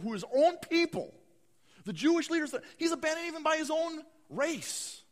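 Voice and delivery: fervent sermon build; preaching at full force. Acted. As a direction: drive the list of betrayals home.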